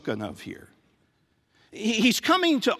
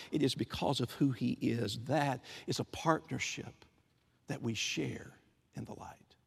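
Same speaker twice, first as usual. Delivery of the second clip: softer and softer until barely voiced; fading to a hush; 5 semitones lower, 7 dB quieter than usual. Preacher drawing the congregation into a hushed close. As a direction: let it sink to a whisper.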